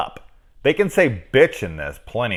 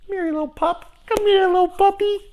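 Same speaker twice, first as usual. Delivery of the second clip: very high squeaky puppy-calling voice; mocking squeaky voice; 5 dB louder, faster than usual. Shown as a demonstration. Mocking the weak line with a squeaky high voice. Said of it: "Doesn't even feel like that's stronger".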